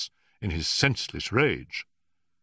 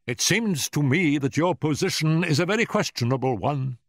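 high, louder, accusing Professor; angry; 9 semitones higher, 5 dB louder than usual. A narrator's reading. Professor challenges Holmes's questionable position, angrily. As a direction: confront the intruder indignantly. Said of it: "glaring angrily at my companion".

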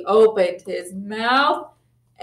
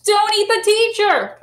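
excited title announcement; 11 semitones higher, 3 dB louder than usual. Shown as a demonstration. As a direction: announce the title with big, high-pitched drama. Announcing the book title to children.

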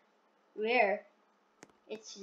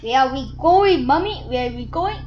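put-on kid asking mom; 2 semitones higher, 9 dB louder than usual. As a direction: call out to mommy anxiously. Child character asking mom.

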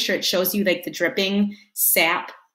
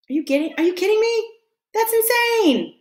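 can't believe it, voice jumping up; amazed; 11 semitones higher than usual. Real, not acted. Amazed at two hundred wingbeats.

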